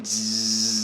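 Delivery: slow long zz like test-pattern noise; TV static buzz. Shown as a demonstration. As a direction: buzz like a TV at closing. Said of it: "화면 조정 소리랑 똑같다".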